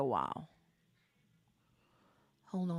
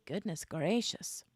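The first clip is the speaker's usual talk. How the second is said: usual speaking voice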